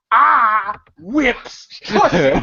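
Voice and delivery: drawn-out, slow, proud; slow boastful drawl. Retold or imitated. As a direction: stretch it out like a boast. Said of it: "John Witherspoon says".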